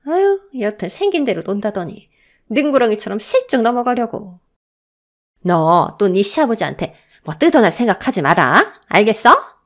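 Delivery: contemptuous scolding, rising at the end; mock her, then bark the warning; sneering mother-in-law. A narrator's reading. Mother-in-law warning her daughter-in-law off, angry.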